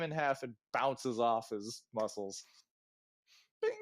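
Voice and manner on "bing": usual speaking voice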